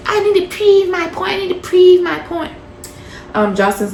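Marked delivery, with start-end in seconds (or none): 0.1-2.5 high squeaky insistent repetition; mock Neek. Recapper mimics Neek whining high at Jocelyn. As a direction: whine it high like a stubborn girl.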